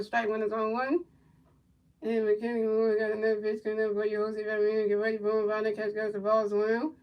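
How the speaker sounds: low, quiet, steady game call; soft running commentary; play-by-play call. Acted. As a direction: murmur the pitches like a broadcaster.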